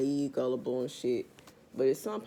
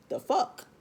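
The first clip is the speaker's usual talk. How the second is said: exasperated disbelief; outraged high "the fuck"; 10 semitones higher than usual. Real, not acted. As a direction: pitch jumps high in disbelief. Outraged reaction.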